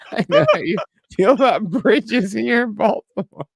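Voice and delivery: high, slow repeat through laughter; amused quoting. Retold or imitated. Host repeats the comedian's joke to co-host, laughing. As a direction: savor the quoted joke, high-pitched and laughing.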